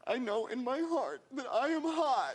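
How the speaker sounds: hammed-up crying reality show reject; mock tearful contestant. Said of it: "they'd be crying, going".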